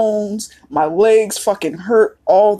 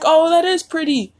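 mock admiring; 8 semitones higher than usual. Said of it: "it's like".

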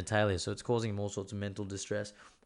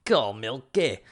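louder mock Glasgow accent; 6 dB louder, slower than usual. Shown as a demonstration. Host replaying the call to Siri for a friend, mock Glasgow accent. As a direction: put on a punchy Scottish accent. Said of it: "I feel like from Glasgow".

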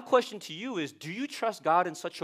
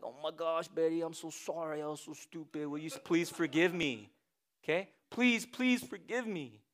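mock pleading apology; 8 dB quieter than usual. Billy begs wife Betty to forgive him, softly pleading. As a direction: beg her forgiveness, hammed-up. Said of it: "he's like".

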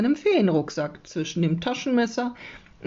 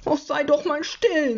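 put-on higher-pitched annoyed boy; irritated, raised pitch; cross; 10 semitones higher than usual. A narrator's reading. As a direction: snap crossly at a pest. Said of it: "sagt Jonathan böse".

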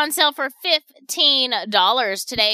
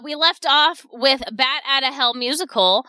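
ordinary talk, nothing marked

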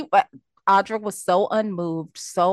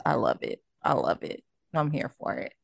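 ordinary talk, nothing marked

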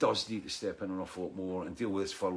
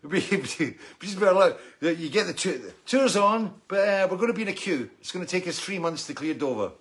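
comic mock dismay, pitched up and louder; excited, higher, louder; 7 semitones higher, 5 dB louder than usual. Real, not acted. Musician to fans, mock dismay about Brexit queues, pitched-up excited voice. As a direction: ham up the horror of a long queue.

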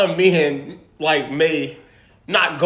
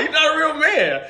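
teasing shout; 8 semitones higher than usual. Acted. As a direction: shout it at him, half laughing. Teasing friend about living alone.

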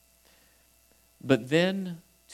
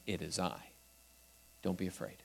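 gentle reassurance; 8 semitones lower, 16 dB quieter, faster than usual. Acted. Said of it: "That's another way of saying".

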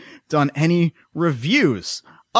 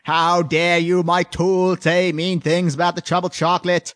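mock Bostonian accent. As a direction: sneer it like a Boston snob. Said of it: "Bostonian condescending accent".

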